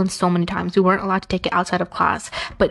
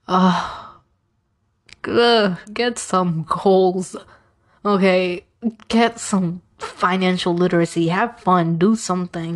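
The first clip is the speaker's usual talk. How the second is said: slow, fed-up ugh and a lecture to the classmates; exasperated; slower than usual. Retold or imitated. Student scolding classmates who fight over shoes, slow and exasperated. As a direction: sigh it out, done with everyone.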